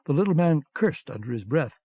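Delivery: usual speaking voice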